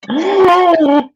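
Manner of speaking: loud, very high drawn-out squeal. Real, not acted. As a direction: let out a sudden high squeal. Reacts to Karen greeting.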